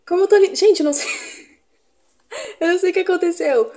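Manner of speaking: loud, high, fast and giddy; excited squeal. Real, not acted. Vlogger squealing at her own reflection, giddy and loud. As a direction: gush with laughter in the voice.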